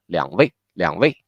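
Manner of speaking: 两 very light and quick, leading straight into 位; super light 两. Shown as a demonstration. Teacher showing how third-tone 两 shortens in first place, super light and quick. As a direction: skim over 两 and land on 位. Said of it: "make it a very super light".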